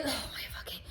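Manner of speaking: whispered cursing under the breath; exasperated whisper. Retold or imitated. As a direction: swear to yourself in a voiceless, fed-up whisper. Acting exasperated muttering at tip request.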